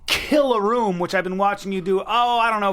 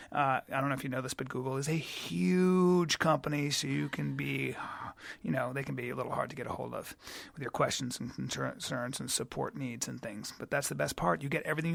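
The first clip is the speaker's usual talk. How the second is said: hushed aside; 4 semitones lower, 11 dB quieter than usual. Acted. Confiding aside about Google support.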